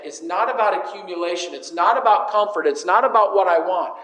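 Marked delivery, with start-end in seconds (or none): none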